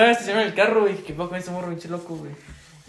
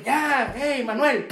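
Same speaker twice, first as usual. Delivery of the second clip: put-on higher scolding yell of an old man; grandpa yelling; 7 semitones higher, much slower than usual. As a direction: shout like the grandfather losing patience. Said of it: "volteé como el abuelo". (abuelo regañando a gritos)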